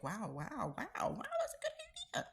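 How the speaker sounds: breathy high amazed wows. Retold or imitated. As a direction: sound blown away by an idea. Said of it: "And I'm like".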